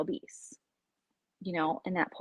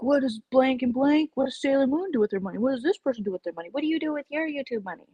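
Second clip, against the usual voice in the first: higher, fast, prying; nosy commenters; 6 semitones higher, faster than usual. Retold or imitated. Imitating commenters nosing into her money, higher and fast. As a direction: rattle off nosy questions.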